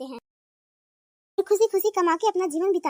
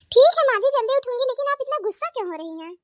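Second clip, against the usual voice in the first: daughter-in-law; 7 semitones higher, faster than usual. Acted.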